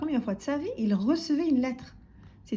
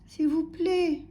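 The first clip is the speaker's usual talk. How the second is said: small, higher, timid voice; Monsieur Silence; 7 semitones higher, slower than usual. A narrator's reading. Timid customer to singing butcher, meek. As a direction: plead meekly for attention. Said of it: "répétait Monsieur Silence".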